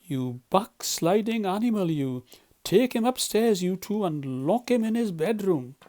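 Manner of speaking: high, loud outraged order; furious Badger. Badger furiously orders Toad locked up.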